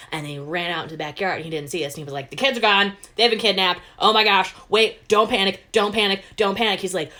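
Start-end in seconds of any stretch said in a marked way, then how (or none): 2.3-6.8 loud, higher, frantic dad yelling in alarm; hammed-up panic, shouting and trying to calm himself; panicked dad. Dad alone in the empty backyard, loud frantic panic. Dad finds kids missing.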